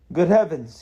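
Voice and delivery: sudden startled exclamation, high and loud; exclaiming. A narrator's reading. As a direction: burst out in astonishment. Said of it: "he exclaimed".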